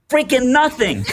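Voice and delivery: blurted out high through a laugh. Retold or imitated. Answering the old man's offer, laughing high. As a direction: laugh it out, overwhelmed. Laughing reply to generous stranger.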